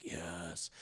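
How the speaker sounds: soft, low, breath-only murmur; hushed yes. Retold or imitated. Mother seeing something in daughter's room, hushed whisper. Mother responds to ghostly sighting.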